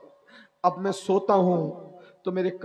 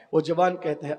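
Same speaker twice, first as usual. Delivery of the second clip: normal talking voice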